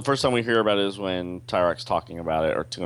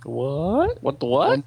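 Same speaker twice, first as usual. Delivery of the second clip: mock surprise; 7 semitones higher, 3 dB louder, slower than usual. Real, not acted. Echoing surprised reaction.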